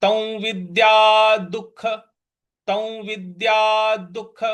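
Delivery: very slow "तौ विद्या दुख", visarga heard as ख; teacher modelling the phrase slowly; slow recitation demo. Shown as a demonstration. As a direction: stretch "दुख" so the ख sound is clear. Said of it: "तो बन जाएगा ये".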